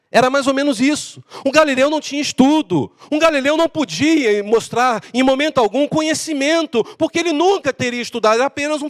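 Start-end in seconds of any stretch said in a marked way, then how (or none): none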